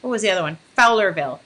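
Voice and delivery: ordinary delivery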